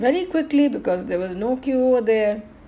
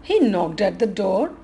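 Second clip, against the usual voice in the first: plain reading voice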